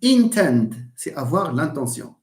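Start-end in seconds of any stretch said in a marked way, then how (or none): 0.0-0.9 "Intend" stressed high on IN; stress on first syllable. Shown as a demonstration. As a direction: punch IN, weak ending on "Intend". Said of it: "it's not".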